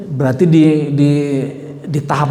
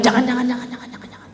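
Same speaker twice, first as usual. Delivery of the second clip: softer and softer until only a whisper; fading to whisper; 7 semitones higher, 8 dB quieter, faster than usual. Acted. The Prophet warning himself not to tell others, fading to a whisper. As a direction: let the warning die into a whisper.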